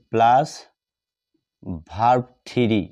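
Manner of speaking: ordinary delivery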